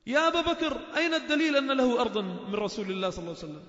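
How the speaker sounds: furious challenge, almost shouting; Umar's angry rebuke. Acted. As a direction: storm in and confront Abu Bakr. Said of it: "ولا عمر داخل".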